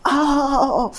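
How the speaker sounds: high, imitated ouch cries of pain; a mock yelp of pain, pitched high. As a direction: act out the yelps of someone being hit. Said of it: "बहुत सारे लोग जैसे दर्द की वजह से".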